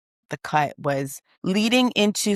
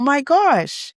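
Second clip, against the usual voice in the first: mock surprise; 9 semitones higher than usual. Reacting to daughter's glare.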